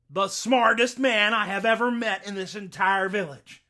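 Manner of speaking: high, loud, delighted exclamations; overjoyed sheriff. Acted. Sheriff to Jack, overjoyed his daughter laughs, loud and high. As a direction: burst out with delight.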